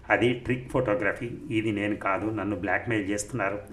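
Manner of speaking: plain reading voice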